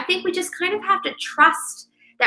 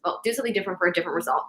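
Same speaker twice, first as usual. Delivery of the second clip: correcting a misquote, rattled off fast; 4 semitones lower, 7 dB quieter, much faster than usual. Shown as a demonstration. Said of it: "No, no, no, that's not what it is".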